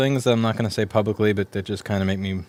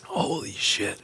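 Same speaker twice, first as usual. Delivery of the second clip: breathless whisper; 2 semitones higher, 7 dB quieter, much slower than usual. Real, not acted. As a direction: barely get the words out. Whispered after wasabi.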